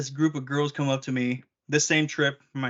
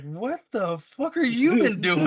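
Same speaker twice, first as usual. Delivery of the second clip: mock shocked; 8 semitones higher, 3 dB louder, slower than usual. Shipmates shocked at his state.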